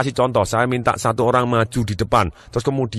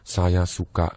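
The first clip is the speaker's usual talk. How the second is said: low, slow, softer voice with pauses; slow feeling-type speaker; 5 semitones lower, 4 dB quieter, slower than usual. Acted. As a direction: savour each phrase slowly and low. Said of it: "orang yang ngomongnya mantap". (lambat, rendah, penuh jeda)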